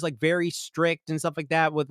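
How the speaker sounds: ordinary delivery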